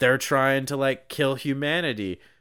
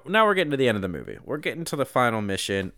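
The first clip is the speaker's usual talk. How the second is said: ordinary delivery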